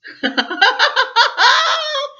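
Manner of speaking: high, loud forced laughter; Man forcing a frightened laugh; roaring laugh. A narrator's reading. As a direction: roar with fake laughter. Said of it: "roared Man".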